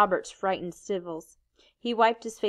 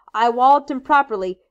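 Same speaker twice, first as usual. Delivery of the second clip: louder, proud bragging; loud boast; 4 semitones higher, 9 dB louder than usual. Acted. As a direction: crow about it. Ralph keeps boasting loudly.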